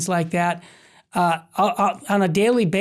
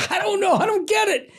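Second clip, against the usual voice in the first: much higher, bewildered mock protest; baffled friend; 13 semitones higher than usual. Friend answering the waiter, high and baffled. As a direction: throw up your hands, baffled. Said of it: "he's like".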